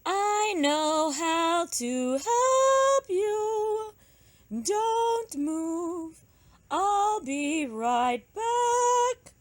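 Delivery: high, very slow magpie. Acted. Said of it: "said the magpie".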